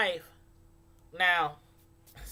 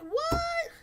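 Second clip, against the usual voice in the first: surprised; 13 semitones higher, much slower than usual. Retold or imitated. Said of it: "I'm like".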